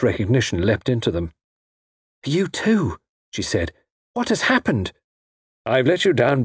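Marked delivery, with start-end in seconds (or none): 2.2-3.7 lightly voiced woman in higher pitch; a touch of startled recognition; bewildered young woman. A narrator's reading. Frida wakes bewildered beside Wilbraham. 4.1-6.4 much higher, anxious questioning voice; bewildered woman. A narrator's reading. Frida wakes bound in the cellar.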